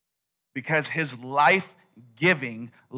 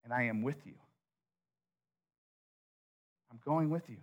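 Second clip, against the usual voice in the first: very soft, steady promise; hushed reassurance; 3 semitones lower, 14 dB quieter, faster than usual. Acted. God calming a doubtful Moses, hushed reassurance. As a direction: reassure him almost under your breath.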